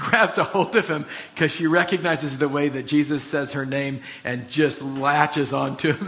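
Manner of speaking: ordinary delivery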